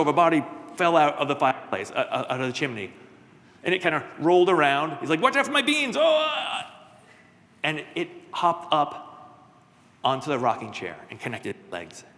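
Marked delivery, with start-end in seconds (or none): 5.2-6.7 much higher, louder startled yelp; startled cry. Acted. Jack jumps as the torso rolls toward his beans, high startled yelp. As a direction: yelp it out as something lands right by you.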